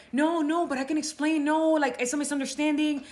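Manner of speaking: high, flustered pleading; panicked rambling husband. Retold or imitated. Caught husband pleading with his wife, high and flustered. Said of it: "he just started rambling".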